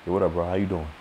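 low, soft, mumbled greeting; imitating a low-energy dismissive greeting; mumbling dismissive guy. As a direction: mutter it without looking up. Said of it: "You're like".

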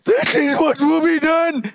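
high, loud public announcement; herald's forced proclamation. Acted. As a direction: cry it out to the crowd. Haman proclaiming through streets.

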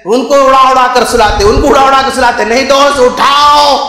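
impassioned preaching. Acted. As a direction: preach it forcefully to the congregation. Preaches at full force. (जोशीला उपदेश)

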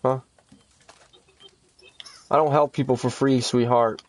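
usual speaking voice

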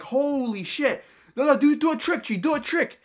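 high-pitched, hyped-up begging; amazed. Retold or imitated. Kids who came to beat him up, now amazed. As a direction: imitate awestruck kids pleading with the magician.

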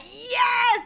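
high, loud whoop of joy; excited yell. Retold or imitated. Actress imitates Dublin crowd cheering, loud high whoop. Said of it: "they were the ones that were like".

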